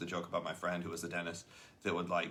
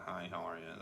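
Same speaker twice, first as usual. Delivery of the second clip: fake smile; 3 semitones lower, 10 dB quieter than usual. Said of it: "grit your teeth".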